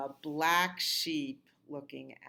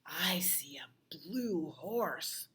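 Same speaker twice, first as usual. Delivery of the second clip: slow, hushed unvoiced whisper; much slower than usual. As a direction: draw the kids in with a whisper. Whispered page reveal.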